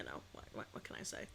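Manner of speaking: whispered helpless shrug. Real, not acted. Streamer giving up on an argument, whispering. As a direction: breathe it out under the breath. Muttered aside.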